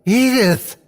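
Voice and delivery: sudden high blurted cry; sharp desperate cry of a name. A narrator's reading. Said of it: "I blurted".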